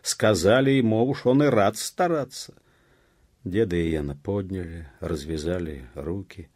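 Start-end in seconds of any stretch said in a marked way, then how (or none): 0.0-2.5 high, loud mocking retort; loud jeer. A narrator's reading. Villager heckles the elder, jeering loudly. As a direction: heckle him over the crowd.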